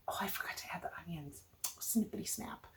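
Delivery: fast whispered aside to herself. Real, not acted. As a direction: whisper it like a little slip-up. Muttering a forgotten step to self.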